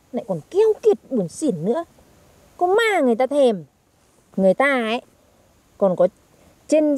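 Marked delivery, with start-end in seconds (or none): none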